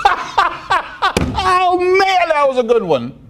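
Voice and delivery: high laughing giddy voice; laughing mock. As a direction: play a giggling, giddy listener. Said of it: "Does that mean you're like".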